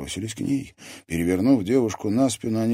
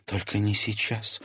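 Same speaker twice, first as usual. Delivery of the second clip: choked, hushed plea; understated strangled rasp; strangled croak; 3 semitones higher, 4 dB quieter than usual. A narrator's reading. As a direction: force it out through a tight throat. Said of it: "сдавленно прохрипела она".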